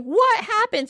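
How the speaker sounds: high, horrified question; shocked cry. Acted. King sees Glossy shriveled on the ground, shocked cry.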